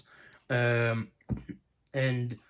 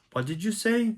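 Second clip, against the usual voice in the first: sharp, much higher pitched retort; angry demand; 8 semitones higher than usual. Retold or imitated. Wanda snaps at Monica in a high, angry challenge. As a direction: Wanda bristling at a remark she hates. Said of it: "that makes Wanda really pissed".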